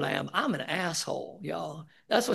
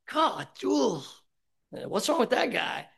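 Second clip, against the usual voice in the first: mock exasperation; 5 semitones higher, slower than usual. Speaker's annoyed inner reaction.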